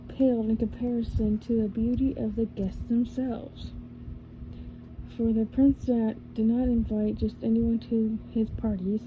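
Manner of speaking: ordinary delivery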